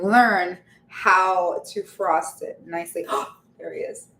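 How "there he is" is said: soft, tender repeated greeting. Real, not acted. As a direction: coo it to a loved one. Greets arriving partner softly.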